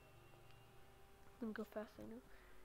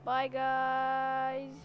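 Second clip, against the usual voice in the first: loud outro bye; 8 dB louder than usual. Real, not acted. Ending video.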